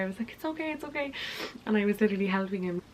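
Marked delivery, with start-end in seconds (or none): 0.3-1.1 soft high reassurance. Retold or imitated. Soothing date after spill.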